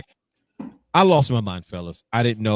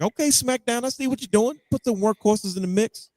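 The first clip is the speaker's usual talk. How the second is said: imitating himself; 12 semitones higher than usual.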